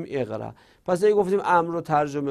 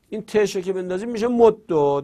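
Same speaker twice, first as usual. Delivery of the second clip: ordinary talk, nothing marked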